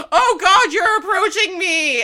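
high, loud hammed-up terror; mock panic. Imitating the pilot George as the enemy approaches, mock panicked cry. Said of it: "And it's the P T S D beloved".

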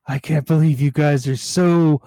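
loud, put-on voice building in pitch; outraged character. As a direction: play a wounded villain's indignant outburst. Hurt Simon protests in character.

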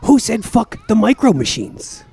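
high, sharp demand; indignant kid. Acted. Roma confronting Dave about the toys, indignant and high. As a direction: challenge him like an angry kid. Schoolyard quarrel.

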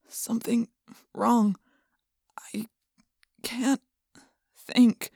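soft, halting, faint words; weak whisper. A narrator's reading. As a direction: barely get the words out. Jake dazed, barely speaking.

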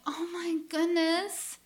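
high, slow cooing mom; mock doting mom. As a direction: coo like a doting mother. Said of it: "My mom is looking like".

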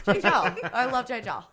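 J Jill with flattened vowels, laughing; mock Chicago vowels. Friend teases the Chicago accent, laughing. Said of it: "what vowel is that".

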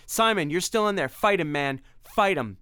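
high loud urging; rally your friend, shouting; pleading shout to fight; urgent shouting. Acted. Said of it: "cried Tim".

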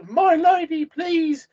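high-pitched, polite plea; high put-on pleading; pleading character. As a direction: entreat the lady in a high voice. Player's character pleads with ghost.

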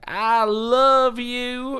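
high, slow, gushing "I love you"; Brother Love impression. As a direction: stretch it out, sweet and high. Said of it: "would come out and just be like".

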